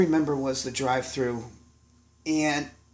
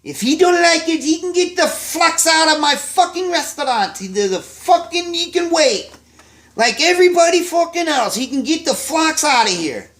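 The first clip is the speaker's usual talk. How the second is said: put-on furious German-accented roar, much higher and louder; 7 dB louder than usual. Chef Hans roaring at a waitress in German accent. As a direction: bellow it like an enraged Austrian chef. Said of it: "And Hans in his German accent".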